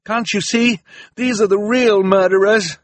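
high, loud plea to a crowd; desperate shouted appeal; shouting. A narrator's reading. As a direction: cry out the accusation to everyone. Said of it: "Klaus shouted to the audience".

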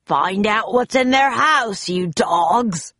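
gruff pirate order, starting high; pirate captain. A narrator's reading. Captain Bones sends his men off, commanding. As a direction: order your crew about like a villain.

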